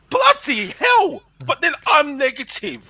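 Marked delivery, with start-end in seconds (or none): 0.1-1.2 high, slow bloody hell; exasperated. Real, not acted. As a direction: let it out in disbelief. Exasperated outburst.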